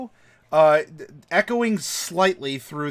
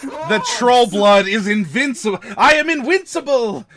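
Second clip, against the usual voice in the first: loud, high mock-triumphant cry, building; hammed-up shout of invincibility; triumphant boast; 6 semitones higher, 7 dB louder than usual. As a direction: crow it at full voice, rising to a shout. Gleeful boast of invincibility in game.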